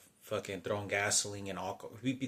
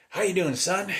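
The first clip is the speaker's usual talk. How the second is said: an imitated beaming greeting, much higher and louder, rising; overjoyed mock father, pitched way up; grinning greeting; 10 semitones higher, 11 dB louder than usual. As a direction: hit it like he just got the golden goose. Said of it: "Like he was real happy".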